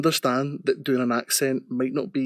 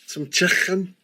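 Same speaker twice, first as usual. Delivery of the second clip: mock Scouse accent, pitched up; bad Scouse impersonation; 5 semitones higher than usual. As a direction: put on a hammed-up Liverpool accent. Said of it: "his bad impersonations of Scousers".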